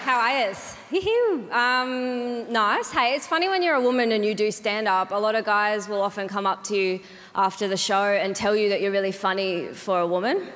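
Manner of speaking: ordinary talk, nothing marked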